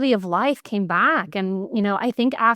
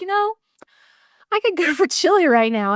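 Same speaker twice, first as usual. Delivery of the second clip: a higher, lightly put-on voice for dad's craving; imitating dad; 9 semitones higher than usual. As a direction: playfully impersonate a dad wanting late-night chili.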